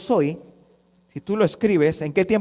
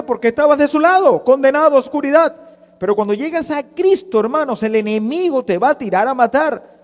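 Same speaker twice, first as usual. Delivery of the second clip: high, urgent pulpit warning; forceful preaching; 7 semitones higher, 5 dB louder than usual. Acted. Preacher warns believers of the enemy's attack, urgent. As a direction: warn urgently with raised pitch.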